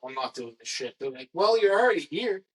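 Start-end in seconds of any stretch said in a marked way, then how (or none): 1.3-2.4 high, louder shrug of a producer; a hammed-up offhand producer; mocking the producers' excuse. Producers voiced in retold story.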